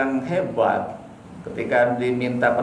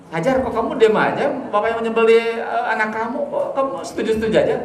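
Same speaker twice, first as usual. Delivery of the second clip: higher, goading mock devil; tempting devil; 6 semitones higher, faster than usual. Preacher voicing Satan goading Hajar, higher and needling. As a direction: poke at her like a sly tempter.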